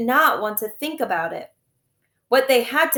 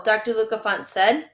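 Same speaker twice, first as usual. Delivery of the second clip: ordinary delivery, nothing marked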